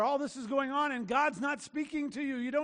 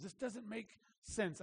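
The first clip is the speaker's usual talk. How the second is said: muttered complaint; 9 dB quieter, slower than usual. Retold or imitated. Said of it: "have heard me say that".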